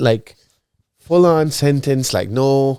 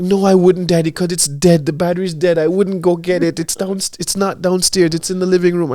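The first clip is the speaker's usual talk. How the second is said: high, loud mimicry of an arguing kid; protesting child; 8 semitones higher, 4 dB louder than usual. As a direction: argue back like an indignant little daughter. Father voices daughter arguing back.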